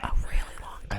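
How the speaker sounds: hushed echo of a prayer; whispering. Real, not acted. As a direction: whisper it back softly.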